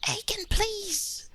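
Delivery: very high breathy whisper; tiny whispered plea. Acted. Lordship whimpers a plea to Aiken, high whisper. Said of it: "He's like".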